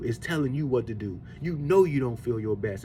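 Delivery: ordinary talk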